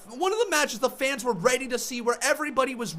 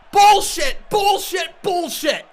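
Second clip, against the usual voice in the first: angry shout; 4 semitones higher, 6 dB louder, slower than usual. Real, not acted. Fan raging at wrestling finish.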